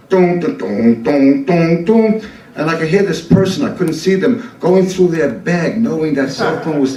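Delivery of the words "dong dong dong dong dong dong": ringtone imitation. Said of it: "I guess that's a cell phone".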